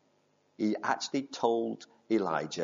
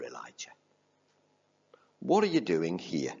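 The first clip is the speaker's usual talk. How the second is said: whispered name; 6 semitones lower than usual. Acted. God asking Elijah in a hushed whisper. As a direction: let the name fall to a whisper. God's question, softly whispered.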